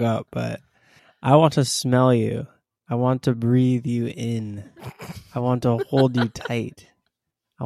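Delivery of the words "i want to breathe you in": usual speaking voice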